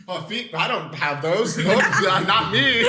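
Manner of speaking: high laughing voice, playing innocent; laughing mock denial. Friends joking, acting Peter hiding feet, laughing mock denial. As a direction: squeal it out through giggles.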